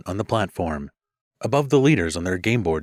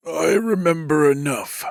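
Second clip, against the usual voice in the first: weak rasping voice; raspy; 9 semitones higher than usual. Acted. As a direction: rasp it out, worn and weak. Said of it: "he rasped".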